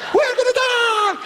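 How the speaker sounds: high, slow terrified yell; panicked scream. Retold or imitated. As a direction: scream like the brakes just failed. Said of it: "He was yellow screaming".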